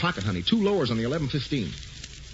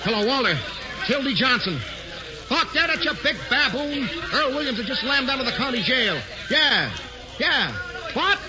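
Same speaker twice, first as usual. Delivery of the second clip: excited; 9 semitones higher than usual. Acted. Hildy phones in the jailbreak story.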